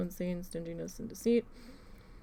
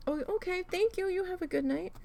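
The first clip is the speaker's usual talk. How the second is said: much higher, sugary fake-polite farewell; sarcastic sweetness; 10 semitones higher, faster than usual. Clerk mock-thanking the customer who stormed out, sugary sarcasm. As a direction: chirp it with mock cheer.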